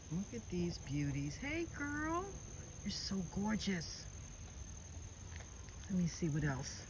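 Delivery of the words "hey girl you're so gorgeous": high, soft cooing hello; high-pitched greeting. Real, not acted. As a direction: coo it softly, way up high.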